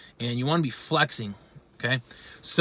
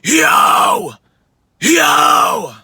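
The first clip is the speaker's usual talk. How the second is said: loud, raised raspy scream; mid scream; 12 semitones higher, 9 dB louder than usual. Shown as a demonstration. Coach showing own mid register, mid scream. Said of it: "Mid scream would be".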